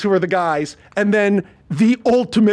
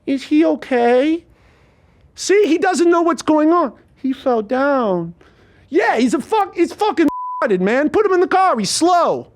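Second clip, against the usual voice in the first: condescending thug; 10 semitones higher, 3 dB louder than usual. Retold or imitated. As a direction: speak about him as if he can't hear. Thug voiced higher, slow.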